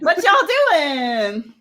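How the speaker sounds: high, slow, stretched Southern drawl; y'all Southern accent. Shown as a demonstration. Californian showing off a new Maryland y'all, in a drawn-out Southern accent. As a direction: draw out a playful mock-Southern greeting. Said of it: "a little bit of a y'all".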